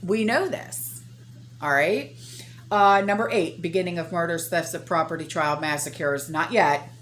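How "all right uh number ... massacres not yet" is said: ordinary delivery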